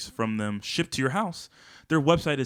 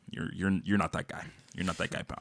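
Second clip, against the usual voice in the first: low gruff quote; 7 semitones lower, 8 dB quieter than usual. Retold or imitated. Quoting a video line.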